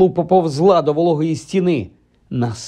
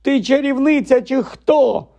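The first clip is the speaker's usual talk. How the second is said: very high, strained outburst; exasperated screech; 11 semitones higher than usual. A narrator's reading. Ron reminding Hermione she is a witch, screeching. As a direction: squeal it like a desperate challenge. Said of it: "заверещав Рон".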